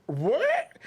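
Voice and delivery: high, loud disbelief; loud stunned question; shocked what. Retold or imitated. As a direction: hit it like a slap of surprise. Shocked reply at the window.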